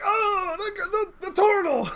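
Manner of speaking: very high, thrilled cry; high-pitched crowd cheer; excited greeting. Acted. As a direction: hail an old friend with hammed-up delight. Gang greets their returning turtle friend.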